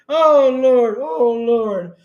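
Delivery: ordinary talk, nothing marked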